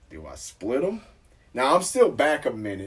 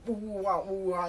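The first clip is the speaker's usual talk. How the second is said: high mumbled radio-chatter noises; mock police radio; 9 semitones higher, 4 dB quieter than usual. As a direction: imitate garbled police radio squawk. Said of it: "talking that police code talk shit".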